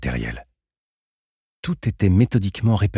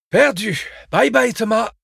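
much higher, louder, taunting cry; gleeful exclamation; 6 dB louder than usual. Acted. Leaving the amphi, she taunts Thomas with a gleeful cry. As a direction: crow it out triumphantly as you leave. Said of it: "S'exclama-t-elle".

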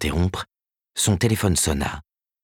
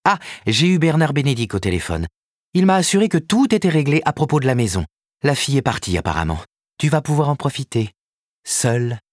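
higher, businesslike woman's voice; lightly voiced Karen, brisk agent; 7 semitones higher than usual. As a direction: report the news briskly.

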